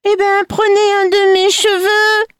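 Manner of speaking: put-on high-pitched, sing-out old-woman voice; vieille fée. A narrator's reading. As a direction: hold it high on one note like an old crone. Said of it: "La vieille lui dit". (voix de vieille, très haut perchée)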